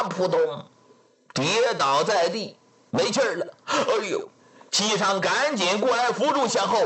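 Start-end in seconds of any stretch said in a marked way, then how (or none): none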